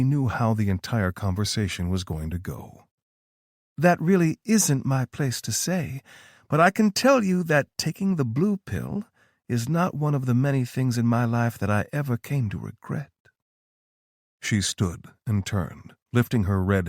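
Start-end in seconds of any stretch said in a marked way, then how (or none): 3.8-13.1 higher, knowing female voice; a knowing, higher-pitched Tara; Tara's voice. A narrator's reading. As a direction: play Tara, calm and sure of herself.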